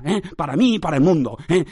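ordinary delivery

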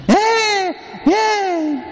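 put-on high, whiny baby wail; baby crying imitation. Priest imitating the crying baby on the plane, high whine. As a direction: mimic a fussing infant's cry.

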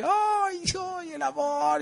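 high, slow sighing ay over love; mock swoon. Preacher mocking sugary love talk, high drawn-out sigh. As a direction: gush theatrically about love.

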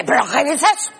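mock the friend who won't leave; party friend voice. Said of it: "y hace".

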